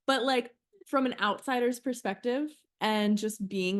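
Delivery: ordinary talk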